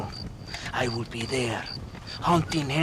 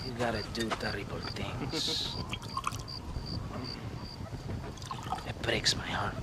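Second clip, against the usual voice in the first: soft whispered aside, lower; 7 semitones lower, 13 dB quieter than usual. Acted. Official whispering his regret to Marston. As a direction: murmur it like a false regret. Official confides after a dark laugh.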